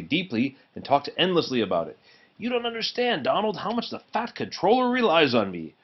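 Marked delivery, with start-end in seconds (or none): 2.4-5.7 higher, fussing little engine; a hint of a self-important engine; fussy engine voice. Duck voiced in story.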